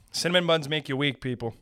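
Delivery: ordinary talk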